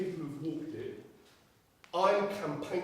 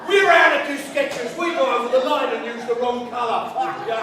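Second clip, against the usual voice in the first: higher, louder mock panic over the radio; mocking guard on walkie; 5 semitones higher, 6 dB louder than usual. Guard on the walkie-talkie to his boss, panicky and laughing. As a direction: ham up a flustered guard reporting in, laughing. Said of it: "we hear him on the walkie-talkie going".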